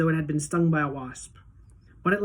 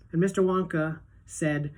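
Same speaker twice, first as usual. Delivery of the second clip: ordinary delivery, nothing marked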